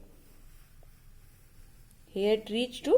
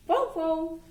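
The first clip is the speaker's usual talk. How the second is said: a light, higher wow wow; dog barking; 6 semitones higher than usual. Shown as a demonstration. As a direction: lightly mimic a dog's bark. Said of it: "A dog barks".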